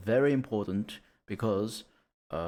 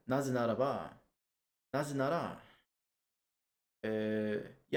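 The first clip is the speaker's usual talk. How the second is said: usual speaking voice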